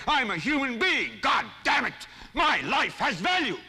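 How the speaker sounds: high, ringing cry of defiance; I'm a human being, shouted to the world; defiant shout. Shown as a demonstration. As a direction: shout it out like a man fed up. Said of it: "You've got to say".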